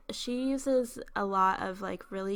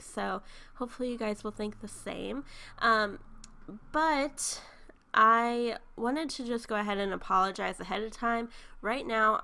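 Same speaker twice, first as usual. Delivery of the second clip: usual speaking voice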